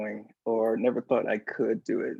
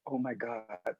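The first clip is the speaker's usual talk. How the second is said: hushed disbelief; 9 dB quieter, slower than usual. Retold or imitated. Boss reacting in awe, retold.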